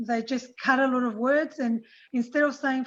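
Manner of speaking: usual speaking voice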